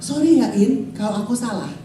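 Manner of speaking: husband's calm apology, acted out; humble apology. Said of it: "Terus dia akan bilang".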